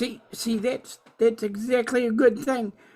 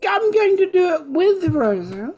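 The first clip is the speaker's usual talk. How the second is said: loud, raised-pitch protest; a bit loud; 6 semitones higher, 4 dB louder than usual. Acted. Character answers a whispered plea to stop, a bit loud. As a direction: blurt the plan out a bit too loudly. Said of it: "that was a bit loud".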